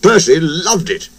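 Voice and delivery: plain reading voice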